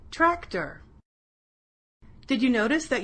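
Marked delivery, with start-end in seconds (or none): 0.1-0.8 "tractor" in two beats, high then falling; syllable demonstration. Shown as a demonstration. Teacher has pupils feel the mouth open, "tractor" in two beats. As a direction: split "tractor" into trac, tor. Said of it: "when your mouth opens and closes".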